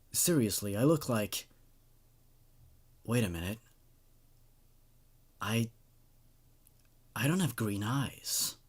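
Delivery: low, subdued storyteller read; story narration. A narrator's reading. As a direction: read the creepy tale in a hushed, even voice. Reads creepypasta story aloud.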